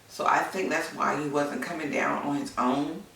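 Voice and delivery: ordinary delivery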